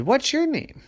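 high, eager question; eager little voice. Acted. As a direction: ask it brightly and quickly. Tortoise asks fish.

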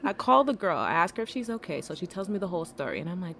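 ordinary talk

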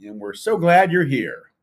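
loud, high-pitched show-opener delivery; punchy podcast host intro. Real, not acted. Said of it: "apparently I have a podcast voice".

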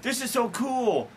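high, shouted fake excitement; mock excited. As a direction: gush like a starstruck fan. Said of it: "it's like".

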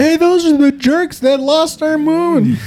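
high, slow laughing jeer; laughing mock jeer; mock jeering crowd. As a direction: point and laugh at them. World mocking America.

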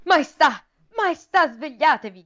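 squire crying out. A narrator's reading. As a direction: wake the king in alarm. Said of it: "gridò uno scudiero". (scudiero che grida per svegliare il re)

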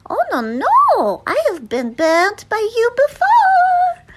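high, loud squealing cartoon feather duster; Fifi. Feather duster protesting she was burnt before, Fifi voice. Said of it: "That's Fifi".

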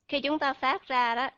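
usual speaking voice

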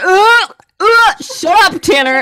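loud, slow, high mock annoyance; wife groaning at husband who corrects her, mock annoyed. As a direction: groan it at a know-it-all husband. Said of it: "I was like".

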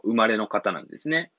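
ordinary talk, nothing marked

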